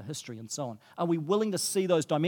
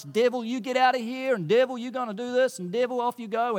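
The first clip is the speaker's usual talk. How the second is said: higher-pitched mock Southern American devil-rebuker; Southern American accent; 5 semitones higher than usual. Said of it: "I'm speaking with a Southern American accent".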